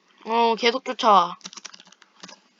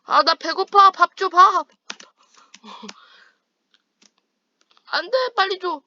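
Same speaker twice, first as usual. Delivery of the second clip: put-on higher, louder childish whine for food; whiny begging; 8 semitones higher, 6 dB louder than usual. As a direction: whine like a hungry kid. Begging teammate for food in-game. (배고픈 아이처럼 칭얼대며)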